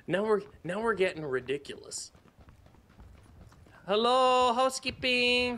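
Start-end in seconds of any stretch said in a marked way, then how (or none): 3.9-5.6 high sing-song maid call; housekeeping voice. Pretend. Mock hotel maid call.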